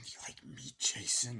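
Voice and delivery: whispered menace. Retold or imitated. Ghostface whispering to Jason on the phone. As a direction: breathe it out in a whisper. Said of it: "re-quoting Ghostface".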